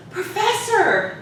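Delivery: put-on high, drawn-out eager call of a kid; youthful childlike student. Student greeting the professor outside class, childlike and eager. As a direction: imitate an excited childlike student. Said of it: "childlike way of speaking".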